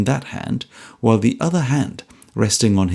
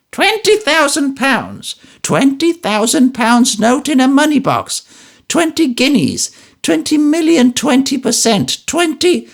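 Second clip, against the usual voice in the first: put-on jabbering old woman; 14 semitones higher, 5 dB louder than usual. Old wife jolted from dozing, jabbering high and loud. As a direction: rattle off sums in a roused old crone's cry. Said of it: "is instantly aroused and jabbers".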